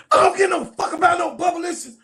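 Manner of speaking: high, loud, laughing as Angel; giggling impression of angry Angel; laughing mock retort. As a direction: spit it out while cracking up.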